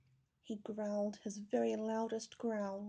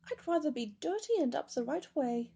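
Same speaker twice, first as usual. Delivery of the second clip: louder, higher pleading; upset cry; 4 semitones higher, 7 dB louder than usual. A narrator's reading. Teddy pinned upside down, crying out in protest. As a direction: complain it out loud, a little higher. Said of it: "he cried".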